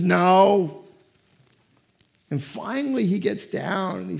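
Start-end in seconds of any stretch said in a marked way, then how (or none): 0.0-0.8 mock raspy toddler refusal; a little kid with a smoker's voice, lightly done; gruff toddler no. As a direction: son refusing his dad, mock gruff. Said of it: "talked like a person who smoked".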